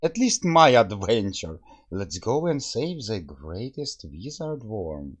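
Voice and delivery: normal talking voice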